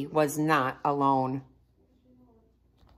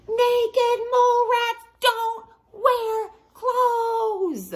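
slow, very high, crying yell; 4 dB louder, much slower than usual. Acted. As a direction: wail it out in despair. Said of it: "Oh, I gotta yell".

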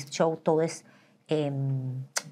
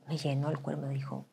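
normal talking voice

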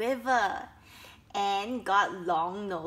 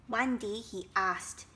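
ordinary delivery

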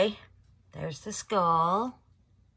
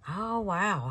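ordinary talk

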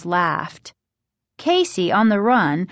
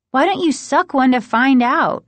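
higher, louder irritated retort; annoyed snap; 7 semitones higher, 5 dB louder than usual. A narrator's reading. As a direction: snap back at an interrupting brother. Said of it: "Margaret snapped, annoyed".

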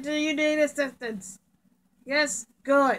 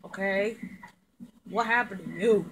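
ordinary talk

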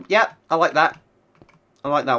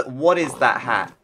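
ordinary talk

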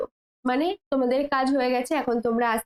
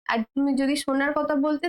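usual speaking voice

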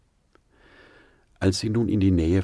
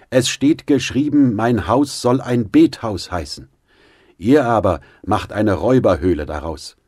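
stern rebuke; 5 semitones higher, 7 dB louder than usual. A narrator's reading. Jesus clearing the temple.

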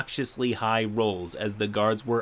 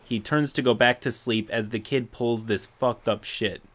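normal talking voice

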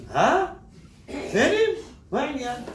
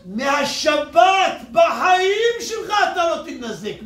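louder and slower, leaning on בחיים; preacher hammering a promise to the crowd, slow and loud; slow preacher's shout; 5 semitones higher, 4 dB louder, slower than usual. Acted. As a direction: shout "בחיים" and stretch the whole line out slowly. Preacher drives home the point.

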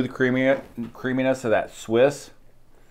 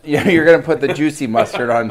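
laughing; 4 semitones higher, 5 dB louder than usual. Real, not acted. Laughing at mustard.

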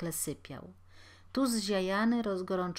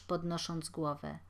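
plain reading voice

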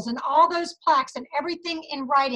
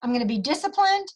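normal talking voice

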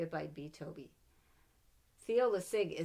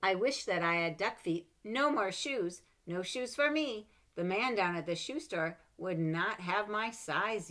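put-on lively read-aloud for children; story reading; 3 semitones higher, 8 dB louder than usual. A narrator's reading. Mother reading a picture book to her child, lively.

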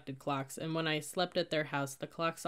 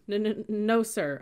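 higher, stuttered frightened reply; croaked stammer; 5 semitones higher, 6 dB louder, slower than usual. A narrator's reading. Cadet answers the furious sergeant, croaked stammer. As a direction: stutter it out, then drop. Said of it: "I croaked".

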